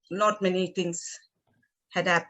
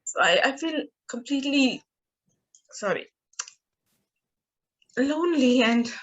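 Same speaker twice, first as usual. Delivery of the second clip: higher, halting voice fighting back tears; emotional, near tears; 3 semitones higher than usual. Real, not acted. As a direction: speak through real tears with long pauses. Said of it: "I'm getting emotional".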